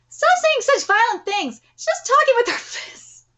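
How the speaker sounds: high-pitched protesting voice; scolding friend. Acted. Telling a friend to stop the violent talk, high protest.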